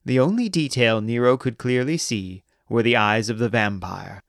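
usual speaking voice

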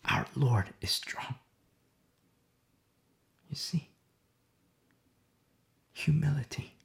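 unvoiced, hushed worship; reverent whisper. Real, not acted. Preacher speaks of God's strength, in a reverent whisper. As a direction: whisper it like a prayer.

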